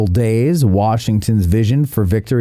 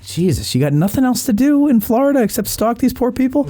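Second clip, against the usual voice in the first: mock exasperation; 13 semitones higher than usual. Son's retort acted in retold call.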